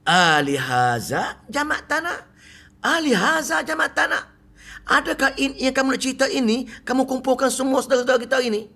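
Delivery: mock outraged Abu Lahab. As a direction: demand why he gathered everyone, hammed up. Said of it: "celaka macam mana".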